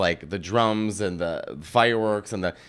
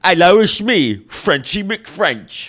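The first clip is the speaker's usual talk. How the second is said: high, loud Cockney caricature; slow, showy Cockney parody; hammed-up Cockney accent; 7 semitones higher, 6 dB louder, slower than usual. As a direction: introduce yourself in broad mock Cockney. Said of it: "the most cockney English accent".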